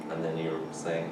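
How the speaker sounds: usual speaking voice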